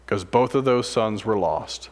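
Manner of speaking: normal talking voice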